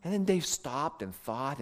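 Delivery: ordinary talk, nothing marked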